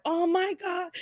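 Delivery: shocked gasp. Retold or imitated. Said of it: "his mom was like".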